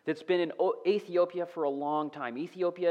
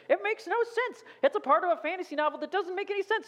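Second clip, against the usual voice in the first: much higher, fast scoffing skeptic voice; mock sceptic; 14 semitones higher, 3 dB louder, faster than usual. Preacher impersonating a scoffing critic of scripture, high and fast. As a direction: dismiss it like a know-it-all critic.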